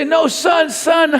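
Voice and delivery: high-pitched urgent fatherly call; lifted, coaxing voice; encouraging parent. Acted. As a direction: rush in to help your son. Acting out an encouraging parent rushing in.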